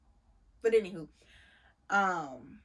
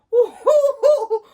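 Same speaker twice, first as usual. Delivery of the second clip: squealing high laugh through the words; 17 dB louder, slower than usual. Real, not acted. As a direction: crack up mid-rant. Laughing mid-rant.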